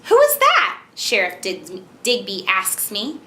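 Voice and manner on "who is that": very high, loud storybook exclamation; expressive character question. Shown as a demonstration. Mother models sheriff's question for child, high and loud. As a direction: pitch the question way up and project it. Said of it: "try and match how I say it".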